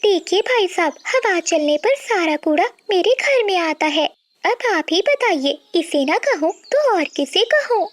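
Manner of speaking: high-pitched aggrieved neighbour; high, indignant bird character; quarrelsome bird, complaining. Acted. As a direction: plead your case in a high voice. Voices Priya complaining to Badal.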